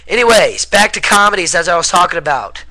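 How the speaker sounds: loud, high, hyper restart; too much caffeine. Real, not acted. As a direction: bounce back in, buzzing on caffeine. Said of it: "I'm wired on caffeine, people".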